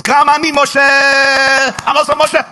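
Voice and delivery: high, loud, drawn-out cry of delight; joyful shout. Acted. Airport greeter shouts with joy at meeting Moshe, joyful shout. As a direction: stretch it out like a long lost reunion.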